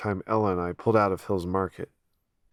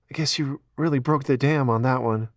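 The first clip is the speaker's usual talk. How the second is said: Ella after crying; 6 semitones higher, 4 dB louder than usual. Acted. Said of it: "Ella after sobbing".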